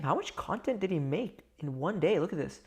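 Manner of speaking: normal talking voice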